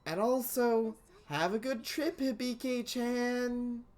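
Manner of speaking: put-on worried older sister fussing at the dock; big sister voice.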